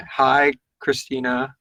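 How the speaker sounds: ordinary delivery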